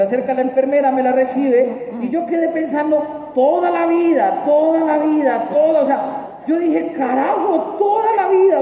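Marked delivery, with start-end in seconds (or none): 3.3-5.8 stunned realization. Acted. Stunned thought at lifelong responsibility. 7.0-8.6 high exclamation of dawning panic; shocked realization. Acted. New father shocked by lifelong duty.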